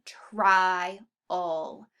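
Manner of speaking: TRIAL slowly split as "TRY... ul"; split vowels. Shown as a demonstration. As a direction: say TRIAL in two syllables, long stressed I first. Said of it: "split because we hear the I and the A".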